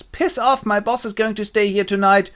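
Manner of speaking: high-pitched cheeky dismissal; bossy fairy employee. Acted. Titania's employee warning off Puck, bossy. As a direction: send him off in a high voice. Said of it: "and she goes".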